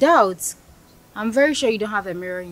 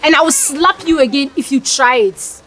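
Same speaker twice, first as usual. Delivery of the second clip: angry threat; 3 semitones higher than usual. Acted. Threatening to slap him.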